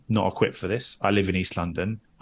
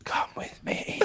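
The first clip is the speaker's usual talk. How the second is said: slow toneless whisper; eerie whisper; 8 dB quieter, slower than usual. Retold or imitated. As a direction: breathe it like a spooky child.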